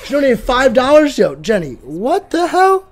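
loud, excited thanks; thrilled streamer shout-out; amazed gratitude. Real, not acted. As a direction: belt out your delighted disbelief. Reacting to a surprise donation.

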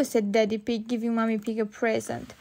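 usual speaking voice

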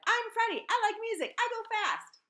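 high, loud, eager shout. Acted. Freddie zooms to the front, shouting to the class. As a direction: announce yourself at full volume. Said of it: "zoomed to the front of that big room and shouted".

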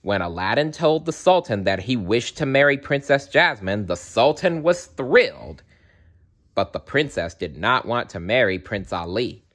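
usual speaking voice

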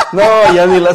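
protesta fuerte y aguda entre carcajadas; suéltalo riéndote a todo volumen; entre risas. Real, not acted. Se ríe de la corrección. (queja divertida, rota por la risa)

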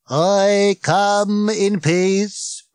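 very slow, booming robot. Acted. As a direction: proclaim from a hundred feet up. Giant robot speaks.

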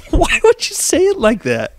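incredulous through laughter; laughing disbelief. Real, not acted. Teasing his friend's wording, laughing. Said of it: "there's no worse way to say that".

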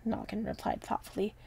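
usual speaking voice

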